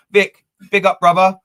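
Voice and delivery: big up, called out loud; loud shout-out. Real, not acted. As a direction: hail a mate across the room. Shout-out to a viewer.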